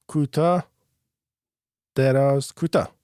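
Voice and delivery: دراز loudest in the middle, final کوتاه falling; short-long-short beat. Shown as a demonstration. Teacher to class, chanting short long short. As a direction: chant it like a drum, دراز strongest.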